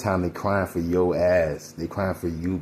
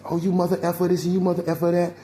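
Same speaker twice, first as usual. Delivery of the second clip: high, fast, louder name-calling rant; imitating someone hurling curses, pitched way up; mock cursing out; 11 semitones higher, 4 dB louder, faster than usual. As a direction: act out cussing someone out at speed. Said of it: "When you curse at a person".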